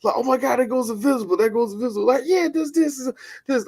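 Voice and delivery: imitating friend's wonder at invisibility; excited discovery. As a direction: gush over the find like a kid. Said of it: "Eric's like".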